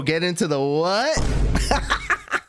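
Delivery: slow incredulous question; drawn-out what. Pretend. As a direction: stretch it out, baffled. Mock confusion at word lore.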